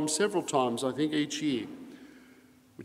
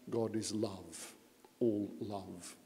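quiet, slow and tender; soft reverent; 6 semitones lower, 10 dB quieter, slower than usual. Real, not acted. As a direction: let it settle softly. Soft devotional close.

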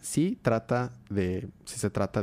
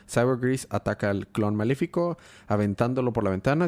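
ordinary delivery

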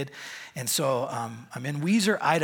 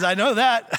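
shouted high and loud; a deaf old man booming back; loud retort; 8 semitones higher, 10 dB louder than usual. Retold or imitated. Bud answers loudly.

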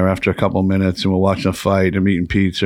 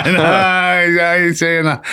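laughing denial; 8 semitones higher, slower than usual. Real, not acted. Laughing denial after a joke.

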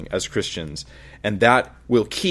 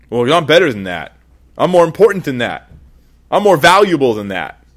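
louder, higher, indignant boast; playing the proud man who refuses to be walked on, loud and indignant; proud retort; 4 semitones higher, 6 dB louder than usual. Voicing the proud reply.

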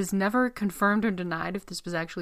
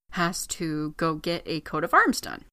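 usual speaking voice

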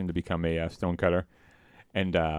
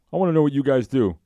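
high, louder demanding voice; imitating Homer; 8 semitones higher, 7 dB louder than usual. Homer presses Lenny and Carl, high and loud. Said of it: "he's like".

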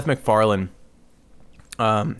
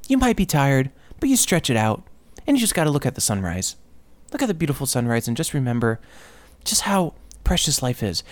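lightly mocking, higher, gentle positive-guru voice; soft-spoken inspirational podcaster; 5 semitones higher than usual. Comedian mocks an upbeat podcast host, higher and gentle. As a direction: imitate the syrupy podcast guy, a touch sing-song. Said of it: "he kinda sounds like this".

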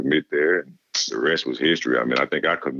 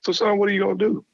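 much higher fast mock coach voice; imitating the coach pressing; faster than usual. Coach pressing the recruit to decide, high quick mock voice. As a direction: push for an answer in the coach's high put-on voice. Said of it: "he's like".

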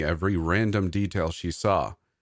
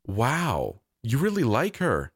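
higher-pitched, playful amazement; exclaiming in mock surprise; 6 semitones higher, slower than usual. A narrator's reading. Uncle marvels at Maisie's friendship, exclaiming in mock surprise. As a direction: act impressed for a small child. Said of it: "I exclaimed".

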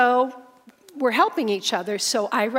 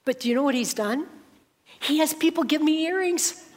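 ordinary delivery

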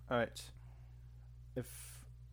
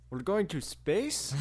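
mock slow line reading; 8 semitones higher, 5 dB louder, much slower than usual.

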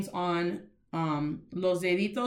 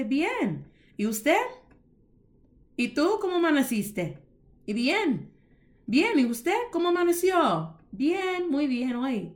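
model dialogue; 6 semitones higher than usual. Shown as a demonstration. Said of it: "You can say".

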